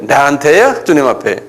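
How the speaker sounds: normal talking voice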